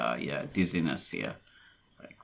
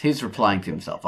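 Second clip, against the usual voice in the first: ordinary delivery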